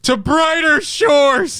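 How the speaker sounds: high, slow mock eulogy; solemn funeral send-off. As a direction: bid farewell like a sea burial. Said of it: "You gotta be like".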